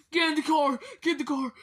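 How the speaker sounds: loud, urgent command; urgent shout. Pretend. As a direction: bark the order to hurry. Urgent order to toys.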